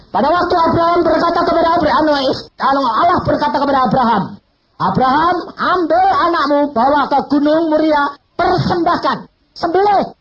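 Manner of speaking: high, loud preaching of God's command; preaching at full force. Acted. Preacher booming God's order to Abraham. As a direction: proclaim it to the congregation.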